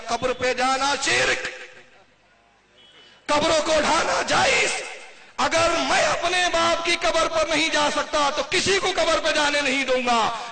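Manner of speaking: high, loud furious decree; angry fatwa. Retold or imitated. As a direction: shout the ban out in rage. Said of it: "غصے میں آیا".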